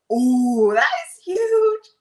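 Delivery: high, loud and slow, truly amazed; excited gasp. Real, not acted. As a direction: let the wonder burst out at "huge". Moved by a viewer's comment.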